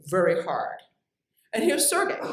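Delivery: ordinary delivery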